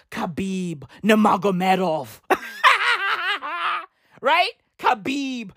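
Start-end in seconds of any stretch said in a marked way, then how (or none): 2.3-3.8 high laugh. Real, not acted. Laughs at own joke.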